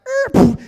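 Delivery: high, loud screech noise; imitated tire screech. Witness retelling a car wreck, imitated screech. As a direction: mimic brakes squealing with a short burst.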